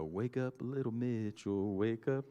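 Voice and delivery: soft, tender coaxing; father gently waking his son, softly; gentle wake-up. Retold or imitated. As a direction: lull a sleeping child awake. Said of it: "he would gently open my door".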